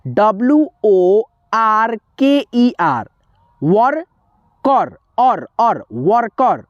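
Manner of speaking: spelled slowly, the er ending said as or; slow teacher spelling; slow spelling drill. Shown as a demonstration. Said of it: "तो कैसे पढ़ेंगे?".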